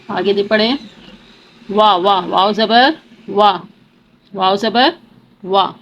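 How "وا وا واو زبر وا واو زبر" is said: normal talking voice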